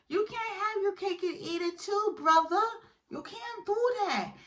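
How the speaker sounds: very high-pitched, squealed telling-off; high comic squeal of disapproval; mock scolding. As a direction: scold him in a hammed-up high voice.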